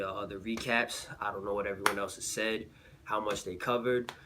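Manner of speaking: normal talking voice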